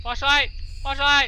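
loud high calls, repeated in a rush; panicked shout. Real, not acted. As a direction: yell for the flashlight in panic. Panicking for the flashlight.

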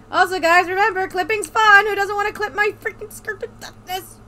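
high, loud reminder to viewers; hyped, loud streamer call-out; loud mock plug. Real, not acted. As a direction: shout it like an ad.

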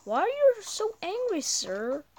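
high teasing question. Acted. Asking the angry crab character.

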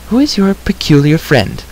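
high-pitched, lightly voiced bard's question; curious bard. A narrator's reading. Bard asking about the new arrival, curious.

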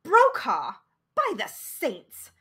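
a startled cry of recognition, loud; astonished gasp. A narrator's reading. Narrator spotting the old doctor on the bus, astonished. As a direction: shout his name in shock. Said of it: "I glanced up from the rear of the bus and gasped".

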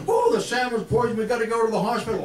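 high, fast panicked cry of alarm; hurry, sound of sheer panic; panicked shout. Acted. Panicked aunt runs over.

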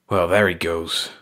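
low-key disgruntled grumble; grumbling. A narrator's reading. Clark after the rabbit gets away, grumbling. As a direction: grumble it to yourself, mildly put out. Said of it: "Clark grumbled".

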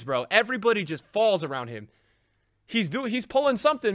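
usual speaking voice

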